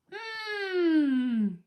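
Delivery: long "mmm", starting high and sliding down; savouring hum. A narrator's reading. Dog enjoys a second tasty treat, savouring hum. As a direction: hum with drawn-out relish.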